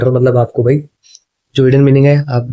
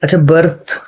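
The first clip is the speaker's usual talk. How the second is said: usual speaking voice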